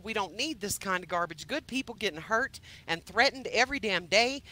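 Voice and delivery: ordinary talk, nothing marked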